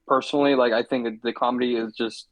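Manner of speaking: normal talking voice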